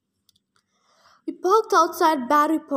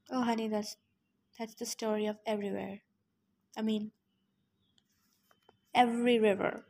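quiet aside; 2 semitones lower, 9 dB quieter than usual. Real, not acted.

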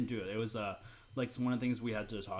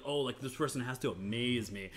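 ordinary talk, nothing marked

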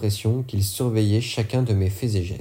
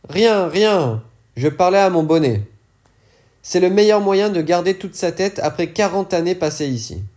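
the dealer brushing it off, higher pitched; higher dealer voice, light and evasive; character voice, higher; 8 semitones higher, 4 dB louder than usual. Acted. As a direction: wave the question away in a higher, amused voice. Fourgueur deflecting.

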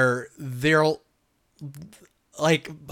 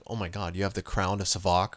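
lightly voiced Doctor Fate, a touch lower and hushed; 5 semitones lower, 5 dB quieter than usual. Retelling Doctor Fate's warning to the hero, lightly voiced and a little low. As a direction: quote the hero a bit lower and softer. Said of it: "he showed up and was like".

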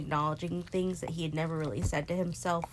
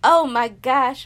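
stunned; 7 semitones higher, 14 dB louder, much slower than usual. Real, not acted. Stunned by nephew's maturity.

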